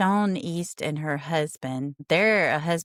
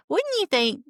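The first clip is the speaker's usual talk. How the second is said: incredulous; 13 semitones higher, 3 dB louder than usual. Real, not acted. Incredulous rhetorical question.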